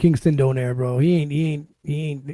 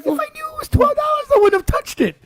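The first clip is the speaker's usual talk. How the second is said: much higher, laughing through the words. Real, not acted. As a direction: squeak it out laughing. Laughing at the punchline.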